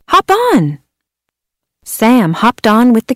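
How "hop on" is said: high bright invite; excited kids calling. Acted. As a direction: sing out the invite. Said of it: "said the kids".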